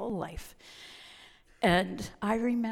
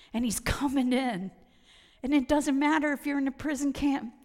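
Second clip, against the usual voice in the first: ordinary talk, nothing marked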